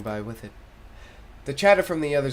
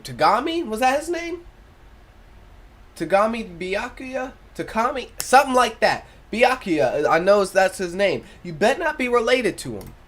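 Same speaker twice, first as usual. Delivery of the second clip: high, excited confused guessing; high animated banter; animated character voice; 7 semitones higher, 5 dB louder than usual. Acted. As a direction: raise the voice, fumble for the name.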